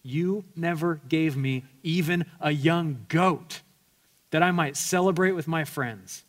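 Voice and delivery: resentful elder son. Acted. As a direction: spit out the grievance at his father. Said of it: "he gets to the real reason he's upset".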